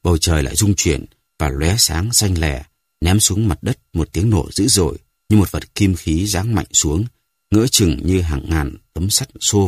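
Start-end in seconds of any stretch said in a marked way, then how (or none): none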